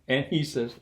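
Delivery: ordinary talk, nothing marked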